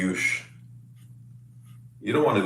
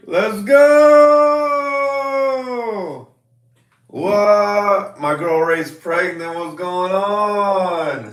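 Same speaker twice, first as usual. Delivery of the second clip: excited disbelief; 9 semitones higher, 3 dB louder, much slower than usual. Real, not acted. Still reacting to pregnancy news.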